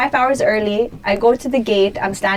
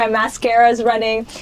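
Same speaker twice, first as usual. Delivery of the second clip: ordinary talk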